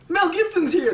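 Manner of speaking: high, thrilled announcement; excited self-quote. Retold or imitated. S1 recalling spotting Mel Gibson at the Oscars, high and thrilled. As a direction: squeal it like a proud fan. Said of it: "I was like".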